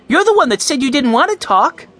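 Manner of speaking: put-on high-pitched child's comeback; squabbling young boy. A narrator's reading. Benny squabbling with Mike over talking, argumentative. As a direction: argue in a high little boy's voice.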